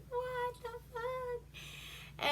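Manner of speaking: tiny, high, drawn-out squeak; mock bewilderment. Herman cornered by the mob, high quiet bewilderment. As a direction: squeak it softly and slowly in falsetto.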